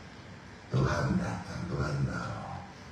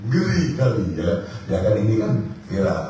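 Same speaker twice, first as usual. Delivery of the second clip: ordinary delivery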